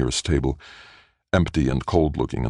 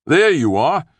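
high, loud call across a room; 13 semitones higher, 6 dB louder, slower than usual. A narrator's reading. Doctor calling to Felicia across an empty cafeteria, raised voice. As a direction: call out brightly to someone far off. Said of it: "Littlejohn's voice called from across the nearly empty".

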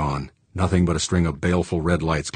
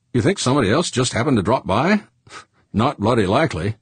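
higher-pitched incredulous comeback; sceptical retort; 6 semitones higher than usual. A narrator's reading. Crewman doubting another ship just dropped by, sceptical. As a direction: scoff at the idea.

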